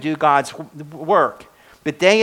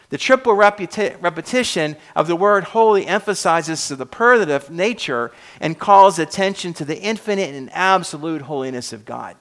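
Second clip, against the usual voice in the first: ordinary talk, nothing marked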